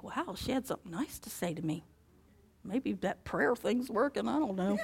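hushed wondering aside; inner thought. Retold or imitated. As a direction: think it to yourself in disbelief.

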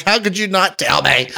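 lightly acted wounded outcry; mock distraught. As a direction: play up a betrayed kid's protest. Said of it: "I was, I was distraught".

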